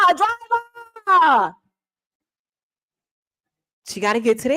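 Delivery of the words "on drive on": drawn-out urging. Retold or imitated. Urging a driver.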